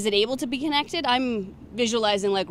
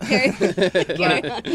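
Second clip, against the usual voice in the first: laughing; 7 dB louder, much slower than usual. Real, not acted. Laughing at own joke.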